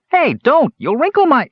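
high, loud protest, cut off; indignant yelp. A narrator's reading. Kender Earwig dragged into an alley, loud indignant yelp. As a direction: squawk at being grabbed.

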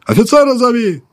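very high, loud bark; barked shout. Acted. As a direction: bark it right by his ear. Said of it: "гаркнула над ухом".

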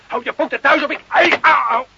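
high, loud cries of pain; pained yelps. Acted. Carlo struggles against the lieutenant, crying out in pain. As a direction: yelp while struggling free.

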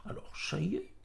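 hushed confidential whisper, rising question; secretive, very soft aside. A narrator's reading. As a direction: whisper it discreetly to the innkeeper. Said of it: "et tout bas".

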